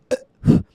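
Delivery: sudden loud burp. Real, not acted. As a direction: let out a short, loud burp. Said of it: "Two burps in one go".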